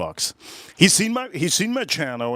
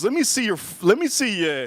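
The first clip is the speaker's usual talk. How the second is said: put-on much higher eager old-man voice; 7 semitones higher than usual. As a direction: rise up high, insistent. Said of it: "he goes".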